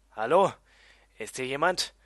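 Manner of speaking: calling out into the dark; Rufen. Acted. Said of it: "rief ich ins schwache Licht". (lautes, fragendes Rufen)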